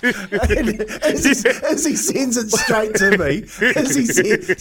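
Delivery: words breaking up with real laughter; laughing. Real, not acted. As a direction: laugh through the line, a bit slow. Laughing while retelling the story.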